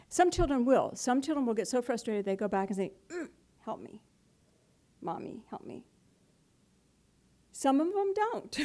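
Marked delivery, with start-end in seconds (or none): 3.1-5.8 soft little plea, much softer; a small child giving in and asking for help; pleading child. Retold or imitated. Preacher voicing a frustrated child who turns back to mommy, softly pleading.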